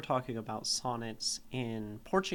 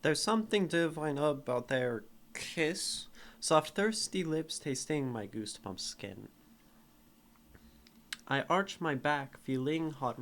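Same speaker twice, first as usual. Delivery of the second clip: poem reading. Acted. Reads her sonnet aloud.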